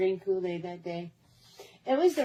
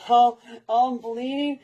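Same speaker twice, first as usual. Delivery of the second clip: laughing; 3 semitones higher, 6 dB louder than usual. Real, not acted.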